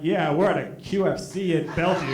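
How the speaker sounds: low-pitched, unimpressed reply; dry, low put-down; deadpan comeback. Acted. Deadpan reply to threatening boy.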